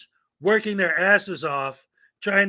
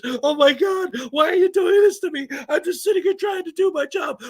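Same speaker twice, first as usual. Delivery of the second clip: much higher, fast, near-tears pleading; crying clerk; 11 semitones higher, 4 dB louder than usual. Acted. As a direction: whimper it like she's about to sob. Clerk crying after being yelled at.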